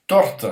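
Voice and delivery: Torte said high and loud, falling; model word. Shown as a demonstration. Teacher modelling Torte for students, emphatic.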